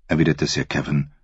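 ordinary talk, nothing marked

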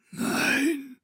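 high, soft dying whisper of a word; a final faint refusal; faint last word. A narrator's reading. A dying man breathes his last no.

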